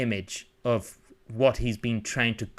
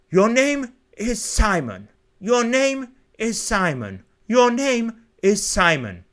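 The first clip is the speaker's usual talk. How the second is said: high, slow, insistent chant; self-reminding butler; 9 semitones higher, 4 dB louder than usual. Acted. Butler at the mirror remembering his name, slow insistent repetition. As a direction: repeat it slowly as a high-voiced mantra. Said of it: "repeating it over and over".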